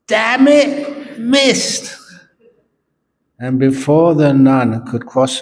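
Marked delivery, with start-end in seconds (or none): 0.1-2.0 frustrated shout. Acted. The Pope loses the match and yells at himself, furious. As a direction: yell it, unable to hold back. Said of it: "he couldn't help it".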